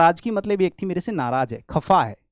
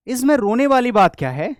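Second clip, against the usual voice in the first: higher, louder, why are you crying; exasperated question; 7 semitones higher, 6 dB louder than usual. Shown as a demonstration. Teacher acting out an exasperated question to someone crying. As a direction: toss it at a crying child. Example of scolding a crier.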